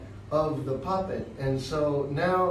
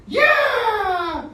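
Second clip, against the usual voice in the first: excited puppet cheer; 8 dB louder than usual. Acted. Puppet cheering after piano.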